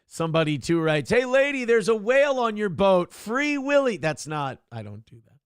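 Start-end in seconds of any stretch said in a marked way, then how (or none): 1.1-4.0 high, hollered jeer at a lady; catcall. Acted. Reads out mock catcall.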